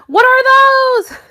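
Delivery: high, loud meme-style yell; mock shout. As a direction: holler it in mock outrage. Meme line shouted after plain take.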